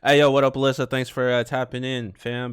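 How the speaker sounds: loud hype shout-out; big streamer shout-out to chat. Real, not acted.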